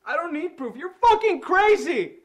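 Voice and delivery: high, loud, slow yell; furious scream. Acted. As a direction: shout that she is crazy. Captive screams at stalker.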